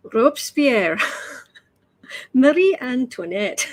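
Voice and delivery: hint of a Scottish teacher naming the names; Scottish accent. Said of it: "I think of it in a Scottish accent".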